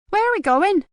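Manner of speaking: a cheeky lad asking keenly; young boy voice. A narrator's reading. Mickey asks Marcus eagerly, in a high young boy's voice.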